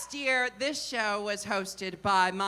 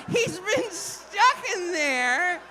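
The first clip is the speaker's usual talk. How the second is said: high, slow, laughing words; can't hold back the laugh; 6 semitones higher, slower than usual. Real, not acted. Laughing through the joke.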